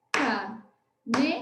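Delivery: ca-ne-ta, sílabas separadas e lentas; syllable-clapped. Shown as a demonstration. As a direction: bata palma em cada sílaba de "Caneta". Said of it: "Vamos ver como é que a gente separa a caneta". (palavra dita sílaba por sílaba, devagar)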